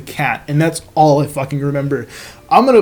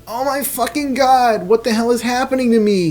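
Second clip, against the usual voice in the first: high panicked cry; 8 semitones higher than usual. Acted. Caillou seeing the blood, high horrified cry. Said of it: "he cried".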